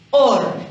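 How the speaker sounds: "or" alone, loud and firm; teacher isolating the stressed "or"; isolated stressed syllable. Shown as a demonstration. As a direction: punch out "or" as a single beat. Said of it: "cargamos la voz".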